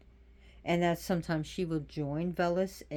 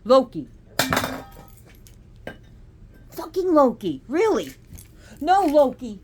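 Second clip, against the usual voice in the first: loud, high angry shout at the pets; yelling at the cats; 7 semitones higher, 13 dB louder than usual. Real, not acted. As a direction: bark it to stop the cats fighting.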